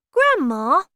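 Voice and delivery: put-on high, loud child calling for attention; boy's voice. A narrator's reading. Boy starts questioning his grandmother, high child's voice.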